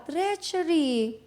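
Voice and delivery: high, drawn-out cry of alarm; mock shout. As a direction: call it out long and high, falling at the end. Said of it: "Sumisigaw siya".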